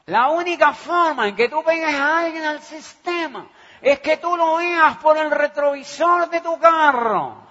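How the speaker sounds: loud, high mentor's maxim; Iván Morales quote. Retold or imitated. Mentor teaching the rule of recruiting, loud and high. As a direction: preach it like the mentor. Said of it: "aprendí de Iván Morales que decía".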